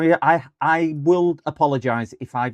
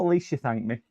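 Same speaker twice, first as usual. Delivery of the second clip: ordinary talk, nothing marked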